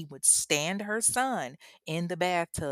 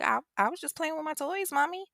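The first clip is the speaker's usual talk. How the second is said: put-on high, small child's voice; six-year-old boy; 10 semitones higher than usual. Six-year-old answering his mother, in an innocent child voice. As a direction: imitate a little kid innocently explaining himself. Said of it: "six at the time".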